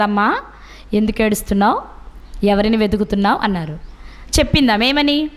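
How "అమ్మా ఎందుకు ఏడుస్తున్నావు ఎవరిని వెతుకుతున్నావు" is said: low-pitched, gently rising questions; lightly voiced Lord questioning a weeping woman; Jesus addressing Mary. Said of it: "ఆ ఏడ్పును చూసి ప్రభు అడుగుతున్నారు". (తగ్గు స్వరంలో యేసు అడుగుతున్నట్టు)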